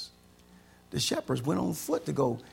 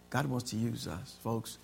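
ordinary talk, nothing marked

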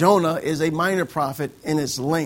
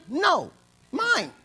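put-on pouty child; 8 semitones higher than usual. Child refusing, voiced high.

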